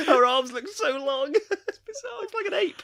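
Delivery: high, loud, helpless laughing; cracking up. Real, not acted. Podcaster mocking a badly drawn character to co-host, cracking up. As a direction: squeal it through real laughter.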